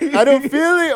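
high, panicked mock cry. Waking after surgery, retold in a high panicked voice. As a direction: squeak it out in retold alarm.

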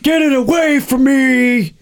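high, slow panicked Nick Cage wail; Nick Cage impression. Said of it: "That was my Nick Cage".

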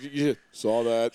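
slow, offhand deadpan; nonchalant. Retold or imitated. Paul catching him fluffing the ball, slow nonchalant deadpan. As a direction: drag it out, totally unbothered. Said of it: "wicked nonchalant".

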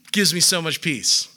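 usual speaking voice

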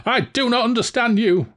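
high, bewildered villain voice; baffled Valjean. A narrator's reading. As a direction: sound lost and thrown, pitched high.

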